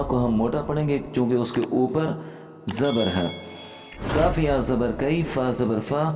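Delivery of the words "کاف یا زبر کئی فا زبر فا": ordinary talk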